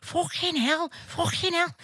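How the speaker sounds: high-pitched mock Scouse exclamation; Scouse accent. Imitating a Scouser colleague after her camp cot flies into a car, high Scouse accent. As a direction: do an exaggerated Scouse squeal. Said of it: "she's a Scouser".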